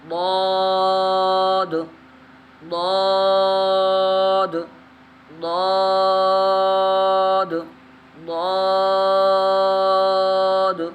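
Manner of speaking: ضاد held long like a Qur'an teacher; slow heavy recital. Shown as a demonstration. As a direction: chant ضاد slow and full. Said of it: "drawn-out letter recitation".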